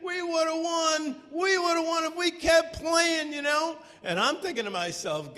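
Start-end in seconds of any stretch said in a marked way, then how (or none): 0.0-3.8 excited lament. Retold or imitated. Players sure they would have won, high excited complaint. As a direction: complain you were robbed. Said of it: "the boys were all like".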